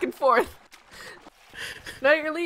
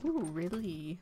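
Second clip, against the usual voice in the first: devious teasing; 5 semitones lower, 9 dB quieter than usual. Pretend. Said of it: "that tone in your voice".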